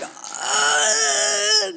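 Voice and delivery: high, strained drawn-out "uh"; stunned noise. Retold or imitated. Overwhelmed by the offer of a gift, high stunned groan. Acts out her stunned reaction.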